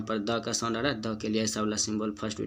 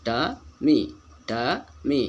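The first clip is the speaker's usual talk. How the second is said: टमी in two beats, the second louder; slow, louder model of टमी; slow reading showing stress; 3 semitones higher, 5 dB louder, much slower than usual. Shown as a demonstration. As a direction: sound out ट then a stronger मी. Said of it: "उसके बाद पढ़ेंगे इसको".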